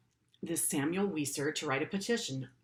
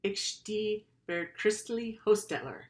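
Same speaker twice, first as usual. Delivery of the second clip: halting German words in a lightly put-on young man's voice, a bit higher; storyteller voicing a young Indian man in broken German; 6 semitones higher, slower than usual. A narrator's reading. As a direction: speak it carefully like a stranger unsure of his German. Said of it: "in broken German".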